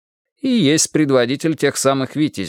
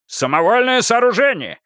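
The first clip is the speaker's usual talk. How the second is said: shouted; 12 semitones higher than usual. Acted. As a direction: yell the phrase in triumph. Karpov seizes on the phrase.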